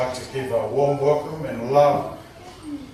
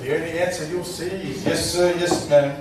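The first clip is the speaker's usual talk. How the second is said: laughing; 5 semitones higher than usual. Real, not acted. Joking to new in-laws.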